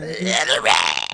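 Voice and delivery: slow, growly goblin. Shown as a demonstration. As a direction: drag it out like a goblin. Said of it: "put more goblin in your voice".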